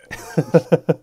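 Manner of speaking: hearty laughter; loud laugh. Real, not acted. Laughing at the impression.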